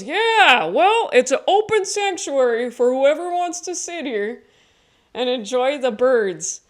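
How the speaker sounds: high, loud mellow hippie voice; cartoonish hippie dude; hippie neighbor. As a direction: lay back like a friendly hippie.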